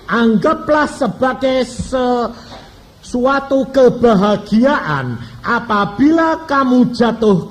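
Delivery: slow, loud and high, reading the verse aloud to the church; solemn scripture reading. Acted. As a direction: proclaim each phrase of the verse slowly. Reads Bible verse from James.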